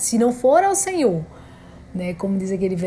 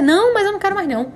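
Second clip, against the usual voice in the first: much higher, fast, pleading to stop; frantic plea; 11 semitones higher, 4 dB louder, faster than usual. Retold or imitated. Mother begging the nurse to stop the needle, high and frantic. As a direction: blurt it out like a panicked mother at her limit.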